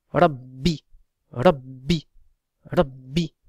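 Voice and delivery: "Rabbi" with a long, doubled b. Shown as a demonstration. Teacher showing students the shadda, doubled consonant.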